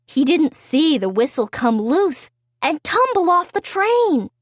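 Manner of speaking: ordinary delivery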